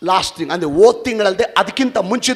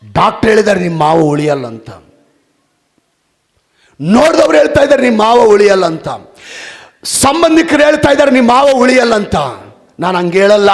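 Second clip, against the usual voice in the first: building from plain to a loud, higher cry; rising preacher cry; 6 semitones higher, 10 dB louder, faster than usual. Retold or imitated. Pastor telling the grieving woman what others say, building loud. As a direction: list the voices of doom with growing force. Pastor rebukes fearful news.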